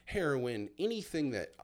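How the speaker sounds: normal talking voice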